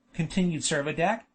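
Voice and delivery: plain reading voice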